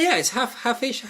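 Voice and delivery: ordinary talk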